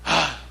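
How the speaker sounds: demonstrative breathy sigh; big exhale, as if finally resting; sigh of relief. Acted. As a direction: let out an acted sigh of relief. Acts out resting in God.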